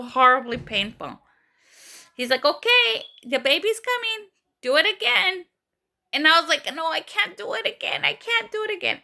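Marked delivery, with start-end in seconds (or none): 2.5-5.5 urging doctor. Retold or imitated. Doctor urging her in labor. 6.8-9.0 high, pleading mock whimper; on the verge of tears. Patient begging the doctor not to, acted on the verge of tears. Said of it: "I'm in the verge of tears".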